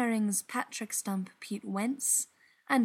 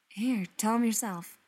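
ordinary delivery, nothing marked

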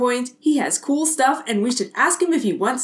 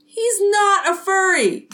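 higher, slow indignant call; calling after her; 5 semitones higher, slower than usual. A narrator's reading. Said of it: "Jake calls at her".

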